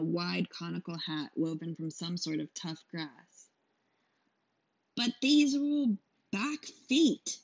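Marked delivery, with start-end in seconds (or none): 5.0-7.4 high, slow haggling merchant; whiny trader objecting; protesting trader. Acted. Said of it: "the trader protested".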